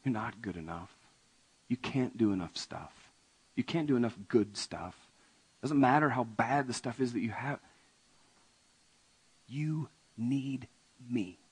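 soft, head-shaking Jesus; Jesus gently telling the rich man he's not good enough; gentle rebuke. Acted. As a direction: sadly tell them they fall short. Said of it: "picture Jesus sort of shaking his head going like".

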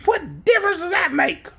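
high hen voice; high-pitched, scoffing hen; challenging hen. A narrator's reading. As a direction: demand it as a talking hen.

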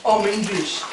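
broad Aussie bogan accent saying I'm English; Bogan Australian accent. Shown as a demonstration. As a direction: lay on a thick ocker Australian accent. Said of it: "Bogan Australian accent".

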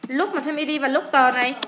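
ordinary delivery

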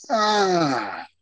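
drawn-out mock "ah"; dawning realization. Wrestler hearing the boss's character idea, mock dawning realization. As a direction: let the idea slowly land, hammed-up.

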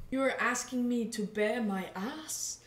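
normal talking voice

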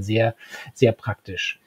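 dry whispered irony, slow; whispered aside. Real, not acted. Ironic aside about the device.

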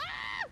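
very high sudden shriek; startled scream. Real, not acted. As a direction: scream as the attack hits. Attacked mid-sentence in the game. (grito agudo de sobresalto)

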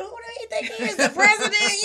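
high, laughing mock outrage; laughing indignation. Teen girl scoffing at her strict dad, laughing. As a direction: laugh through the indignant question. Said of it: "in my mind, I'm thinking".

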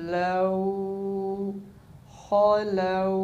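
खलौ stretched with a long au; slow recitation. Shown as a demonstration. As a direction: chant खलौ slowly. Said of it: "लाम वाव ज़बर".

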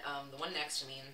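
ordinary talk

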